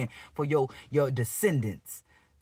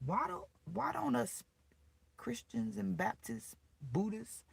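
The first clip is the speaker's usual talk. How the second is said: ordinary talk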